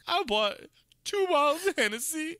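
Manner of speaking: put-on high, loud whiny drunk voice; imitating drunk rider. Driver mocking the drunk passenger's complaint, high and loud. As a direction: wail about the bottles. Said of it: "he's like".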